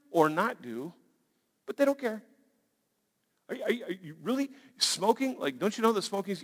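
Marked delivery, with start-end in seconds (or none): none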